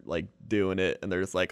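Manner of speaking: usual speaking voice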